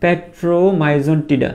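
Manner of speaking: petromyzon tida spaced out very slowly; teacher leading a repeat-after-me drill; slow pronunciation. Shown as a demonstration. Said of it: "আমার সাথে সাথে করে একবার দুইবার একটু উচ্চারণ করে ফেলো".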